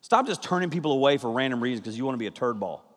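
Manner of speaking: ordinary talk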